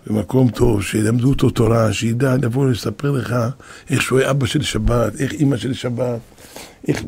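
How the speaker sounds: ordinary talk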